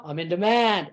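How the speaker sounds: low, firm mantra. Shown as a demonstration. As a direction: drop your pitch and state it. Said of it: "just lower your voice and say".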